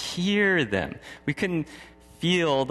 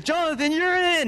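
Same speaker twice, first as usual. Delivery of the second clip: high, projected sideline shout, acted; coach calling out; 13 semitones higher, 3 dB louder than usual. Coach calls Jonathan onto the field, high calling shout. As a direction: send a player in, imitating a coach. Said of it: "coach calls him on the field and goes".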